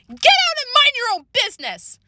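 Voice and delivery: high-pitched shouted order; angry shout. A narrator's reading. Girl orders big sister from her room, angry shout. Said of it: "I shouted".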